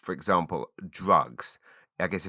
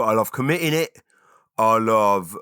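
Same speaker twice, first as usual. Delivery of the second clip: mock villain confession; 3 semitones higher, 6 dB louder than usual. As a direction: relish the wicked joke. Joke confession, put-on voice.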